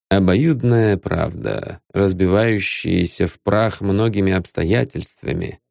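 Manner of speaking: plain reading voice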